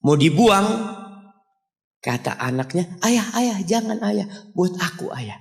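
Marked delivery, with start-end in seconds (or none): 3.0-5.4 put-on pleading child. Child begs father to keep plate.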